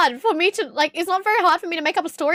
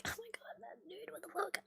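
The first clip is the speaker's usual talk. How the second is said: barely audible whispered aside; hushed, voiceless private reaction; stunned whisper; 6 semitones higher, 25 dB quieter than usual. Retold or imitated. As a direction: whisper it under your breath in disbelief. Stunned aside on being sized up.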